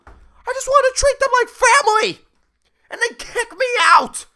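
high, loud indignant rant; ranting CEO. Retold or imitated. Hugging CEO justifying himself to Sharon, high loud rant. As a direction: rant like a wounded man-child.

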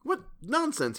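very high, airy dismissal; high-pitched, humouring scoff; dismissive reply. A narrator's reading. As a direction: brush it off lightly in a high voice. Lewis dismisses the mad talk.

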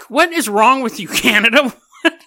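amused disbelief; laughing exasperation. Real, not acted. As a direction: scold Canada while laughing. Mock exasperation at Canada.

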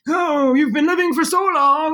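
high, slow mock voice of a worn-out body; a creaky old body grumbling at its owner; the body talking. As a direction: voice the tired body, drawn out and high. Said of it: "my body's going like".

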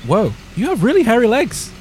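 cartoon character voice. Jordan character voice in skit.

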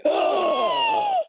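loud, high-pitched gasp of shock; horrified gasp. Retold or imitated. Reacting to the creature in her dream, horrified gasp. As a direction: re-enact a big, shrill gasp of fright. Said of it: "immediately I was like".

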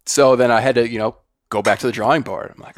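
normal talking voice

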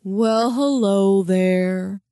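slow, loud, mournful drawn-out hello; sad hound. A narrator's reading. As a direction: glum dog drawing out every word. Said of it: "Hank said sadly".

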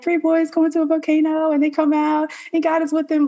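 high-pitched, singsong Bible-story retelling; kids version. Retold or imitated. Daughter recalling the childish Bible tale she knew, high kids-version voice. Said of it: "the Vacation Bible Kids version".

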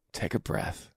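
hushed coaching whisper; soft calming whisper. Shown as a demonstration. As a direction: whisper it gently, almost no voice. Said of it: "take a second and take a breath".

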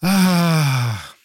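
long, voiced sigh; acted sigh. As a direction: let out a drawn-out "ah" of a sigh. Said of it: "And Pinchas sighed".

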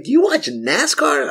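slow, very high-pitched incredulous imitation; high, laughing mimicry of disbelief; mock disbelief. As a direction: draw out their amazed question, laughing. Mimics people's disbelief at nascar.

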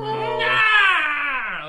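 high loud screaming cry; monster shriek. Acted. Creature leaping at Philippe, high loud shriek. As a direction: let out a wild beast scream.

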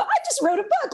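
high, beaming brag; proud announcement. Retold or imitated. Telling the store clerk about her new book, proudly. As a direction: show off a little, delighted. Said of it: "I was all proud of myself".

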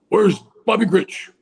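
a lightly voiced president calling out for a player; imitating Nixon. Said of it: "You know, like that".

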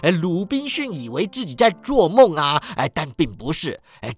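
animated, exclaiming storyteller; excited yelling. A narrator's reading. Scrooge relives Crusoe and Friday, excited yelling. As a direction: tumble on with boyish delight. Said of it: "史古基大叫".

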